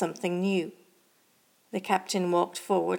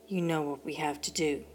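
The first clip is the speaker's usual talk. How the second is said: low, soft and weighted; quiet; 3 semitones lower, 6 dB quieter than usual. A narrator's reading. Captain to co-pilot through an implant, quiet. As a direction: say it softly, lower than usual. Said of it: "spoke quietly through her carbon implant, her words landing softly".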